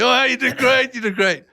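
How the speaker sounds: imitating praising wife. Husband imitating his wife's praise, high mock gushing. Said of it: "My wife say".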